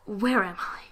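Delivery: lost whisper to himself. Acted. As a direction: breathe the question in confusion. Dazed stranger wondering.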